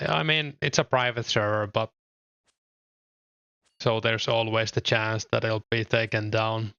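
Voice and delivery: ordinary talk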